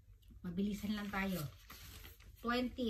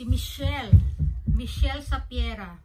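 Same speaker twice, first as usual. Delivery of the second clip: loud, slow drawn-out winner call; 14 dB louder, slower than usual. Real, not acted. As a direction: belt out the name of the winner. Calling out the raffle winner.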